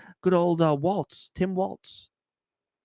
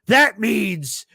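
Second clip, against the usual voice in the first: high, loud scolding rant; mock outraged shout; 6 semitones higher, 5 dB louder, slower than usual. Streamer mocking Kamala supporters, loud shouting accusation. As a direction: ham up a self-righteous accuser. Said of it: "They're coming on out and be like".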